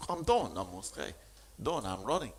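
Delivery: ordinary talk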